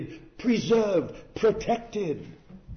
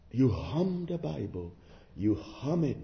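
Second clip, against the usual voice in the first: ordinary delivery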